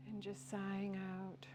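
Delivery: soft, unhurried breathwork guidance; guided breathwork. Acted. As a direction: guide the class gently into the stretch. Leads a breathwork stretch.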